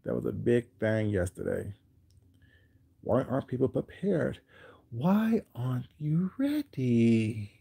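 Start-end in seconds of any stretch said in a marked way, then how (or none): 4.9-7.5 a hint of a cold boss, slow and soft; lightly voiced icy fashion editor; slow, quiet, cool disdain; Miranda voice. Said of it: "In my Miranda voice from, um, Devil Wears Prada".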